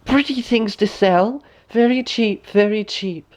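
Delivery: disguised queen crying wares at the door; old peddler woman. A narrator's reading. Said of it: "knocked at the door and cried".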